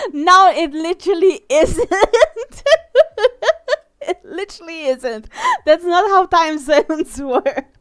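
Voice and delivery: loud, high, laughing protest; cracking up while objecting; real helpless laughter. Real, not acted. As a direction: argue through a fit of giggles. Cracking up at time zone error.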